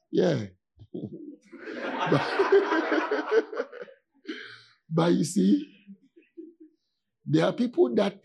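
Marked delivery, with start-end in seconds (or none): 2.3-3.8 high laugh. Real, not acted. Laughing at own claim.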